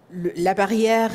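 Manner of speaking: normal talking voice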